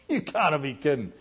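imitated scoff after a laugh; mild mocking dismissal; mock scoffing laughter. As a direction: act out laughing in disbelief at God. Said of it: "laughing at God and going".

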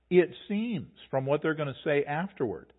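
usual speaking voice

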